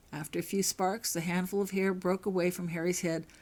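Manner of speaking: ordinary delivery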